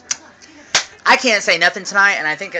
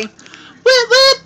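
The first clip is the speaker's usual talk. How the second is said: short, loud, very high whoops; excited whooping; 9 dB louder than usual. Real, not acted. Celebrating payday tomorrow, excited high whooping.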